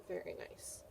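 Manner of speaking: soft unvoiced very nice; hushed approval; whispered praise. Real, not acted. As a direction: breathe the praise quietly. Teacher praises quietly.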